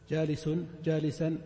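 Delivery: جالس as jalisun, جالسا as jalisan; tanwin endings. Shown as a demonstration. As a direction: sound -un on جالس, then -an on جالسا. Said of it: "لأنها تقبل التنوين".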